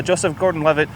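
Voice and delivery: ordinary delivery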